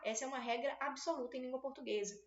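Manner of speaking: ordinary delivery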